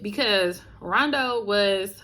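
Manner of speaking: normal talking voice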